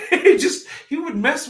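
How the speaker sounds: high laugh breaking into the words. Real, not acted. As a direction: giggle over the start of it. Laughs recalling brother-in-law.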